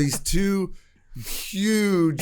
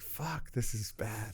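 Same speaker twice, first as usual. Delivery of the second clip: much softer, a dismayed aside under the breath; muttered panic; 4 semitones lower, 19 dB quieter, much slower than usual. Retold or imitated. Blocked in by two huge guys, muttering to himself in soft dismay. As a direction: say it to yourself as the trap closes.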